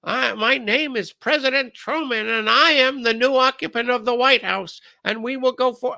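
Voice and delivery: high-pitched mock presidential voice; President Truman. New president on the radio, high imitated voice. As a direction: pitch it way up and hold it there. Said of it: "we heard this guy talking like this".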